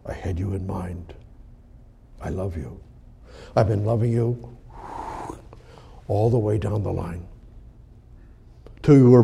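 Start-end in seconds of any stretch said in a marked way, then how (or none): 0.1-2.8 whispered, much softer and lower; a soft tender whisper of love; hushed whisper. Acted. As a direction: breathe it like an intimate secret from God. Voicing God's tender words.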